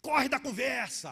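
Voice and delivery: loud, slow, high taunt; fierce wife. Retold or imitated. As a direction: imitate an angry wife yelling a drawn-out accusation. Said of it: "E ela falava assim".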